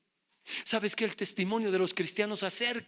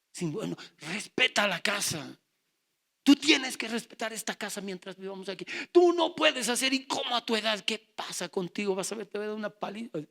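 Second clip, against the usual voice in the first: scolding father; 5 semitones higher, 5 dB louder than usual. Retold or imitated. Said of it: "Y me dice".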